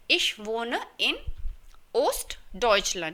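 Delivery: each German word said very slowly and clearly; slow model sentence. Shown as a demonstration. Teacher modelling a Berlin resident's sentence, very slowly. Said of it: "तो आप कहेंगे".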